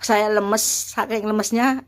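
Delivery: ordinary delivery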